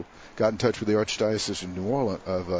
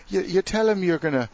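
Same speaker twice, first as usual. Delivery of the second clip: put-on Irish accent; 10 semitones higher than usual. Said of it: "It's an Irish accent".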